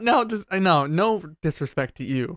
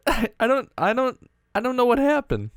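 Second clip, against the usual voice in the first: usual speaking voice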